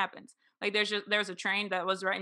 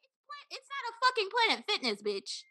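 high-pitched mock outrage; indignant rant; 14 semitones higher than usual. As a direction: scold an absent stranger, pitched way up. Ranting at the absent stranger.

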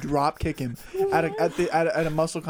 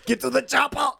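higher, louder action-hero yell; Arnold voice; 11 semitones higher, 5 dB louder than usual. Acted. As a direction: bark it like a frantic action hero. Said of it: "This is".

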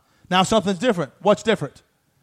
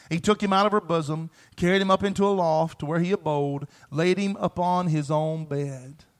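ordinary talk, nothing marked

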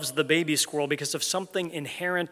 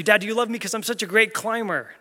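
put-on higher, louder little boy voice; child squirrel begging for praise; eager kid squirrel; 7 semitones higher, 5 dB louder than usual.

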